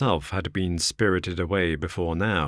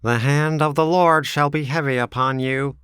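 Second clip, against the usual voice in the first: higher, louder fiery curse; cried out; 8 semitones higher, 5 dB louder than usual. Acted. Drebber cursing Ferrier, a higher loud cry. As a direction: call down judgment. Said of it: "cried young Drebber".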